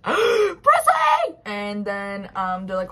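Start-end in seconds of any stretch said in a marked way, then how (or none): 0.0-1.3 very high, loud panicked shriek; freaked-out scream. Acted. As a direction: scream the name out in full panic. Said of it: "that's when Tamsin freaks out".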